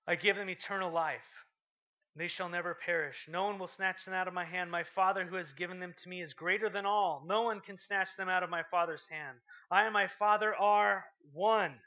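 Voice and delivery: loud preaching, slowing on the last line; forceful scripture reading. Acted. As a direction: proclaim it to the crowd.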